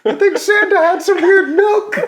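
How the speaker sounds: put-on high, loud squeaky child; mock child voice on the edge of laughter; little kid voice. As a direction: pipe up like a puzzled small kid. Acts child talking about Santa.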